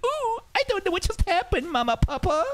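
mock childlike bewilderment; child voice. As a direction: play a confused small child. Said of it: "You're gonna be like".

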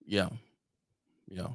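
ordinary talk, nothing marked

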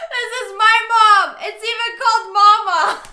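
high loud words through laughter; squealing with laughter; laughing hard. Real, not acted. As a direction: talk while cracking up. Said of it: "My mom does that shit".

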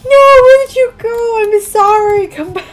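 high pleading call after him. Real, not acted. Calling after a fleeing creature.

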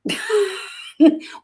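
high burst of laughter; high amused laugh; real laughter. Real, not acted. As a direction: crack up at the co-host's bit. Amused by the Satanologist joke.

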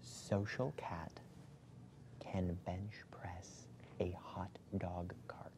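slow, careful whisper. Shown as a demonstration. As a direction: whisper it slowly, word by word. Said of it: "who's gonna whisper to me".

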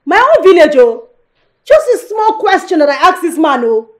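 very loud, high protest; loud aggrieved outburst; indignant wailing shout. Acted. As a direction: cry out, affronted by the insult. Wife wails at husband's insult.

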